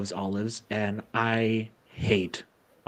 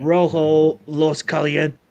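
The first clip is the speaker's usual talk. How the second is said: mock Spanish; 3 semitones higher, 5 dB louder, slower than usual. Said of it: "announcing the flavour".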